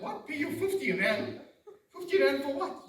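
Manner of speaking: high, loud mock indignation; hammed-up disbelief in an argument; incredulous protest. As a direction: push back at an absurd demand. Acted quarrel with the angry seller.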